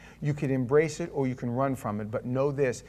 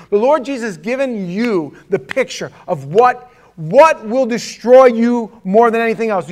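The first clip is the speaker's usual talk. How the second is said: loud, emphatic sermon delivery; forceful preaching; 6 semitones higher, 10 dB louder than usual. Real, not acted.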